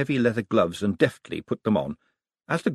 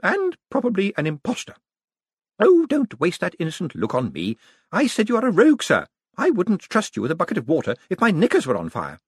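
high, louder cheerful jab; cheerful old lady; 10 semitones higher, 4 dB louder than usual. A narrator's reading. Old lady cheerfully calling Moist a liar, high and loud. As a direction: call him liar with glee. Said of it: "said Missus Lavish".

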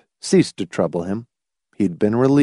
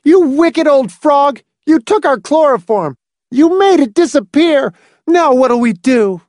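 high, loud hysterical shrieking; a boy shrieks at his mother in despair; 6 dB louder than usual. A narrator's reading. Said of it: "and shrieked".

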